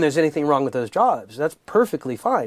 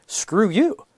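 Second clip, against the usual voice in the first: blunt subtitle; 7 semitones higher than usual. Retold or imitated. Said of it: "the subtitle".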